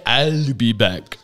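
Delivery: very slow, low catchphrase impression; Arnold. Director telling the actor what to say, slow imitated catchphrase. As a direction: drag it out low like the movie cyborg. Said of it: "Arnold had an argument with the".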